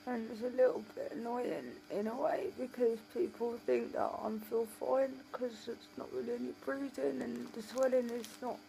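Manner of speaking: usual speaking voice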